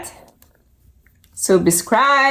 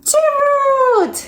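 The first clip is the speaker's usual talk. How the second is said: high, bright call of a friend's name; excited greeting; 14 semitones higher than usual. Acted. Piggie calling out to her best friend, high and eager. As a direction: call out happily, pitched way up.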